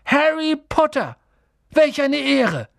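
put-on high, loud, overjoyed old barman; thrilled, ringing outburst; awed excitement. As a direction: exclaim it as if meeting your hero. Barman awed at meeting Harry.

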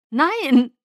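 lautes Nein durch zusammengebissene Zähne; durch die Zähne. Acted. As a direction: presse es wütend heraus. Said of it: "sprach mit zusammengebissenen Zähnen". (Edward wehrt sich gegen die Gefahr, gepresst und laut)

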